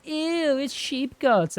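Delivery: mock disgusted kid at school camp. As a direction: squeal it like a kid who hates the food.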